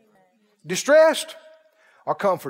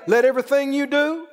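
raised preaching voice, building; 4 semitones higher than usual. Acted. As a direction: preach it out to the church. Preaching to congregation.

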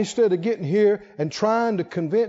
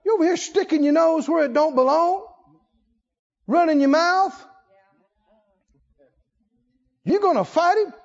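high, scornful big-brother put-down, lightly laughing; mock scolding; 6 semitones higher than usual. David's brothers scold him for talking big, with a mock sneer. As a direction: sneer at him like an annoyed older brother, half joking. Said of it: "David's brothers came over and said".

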